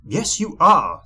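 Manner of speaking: snapped retort. A narrator's reading. Rude Mister Uppity contradicting the goblin, snappish. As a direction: snap back rudely. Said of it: "snapped Mister Uppity".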